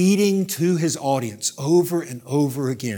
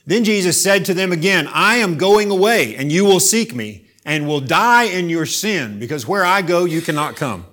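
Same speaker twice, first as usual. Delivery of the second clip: louder, higher preached verses; forceful scripture reading; 7 semitones higher, 8 dB louder than usual. Acted. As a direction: proclaim it with warning. Reads scripture at full force.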